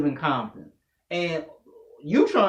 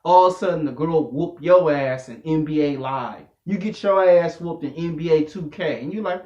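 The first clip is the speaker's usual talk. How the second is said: ordinary talk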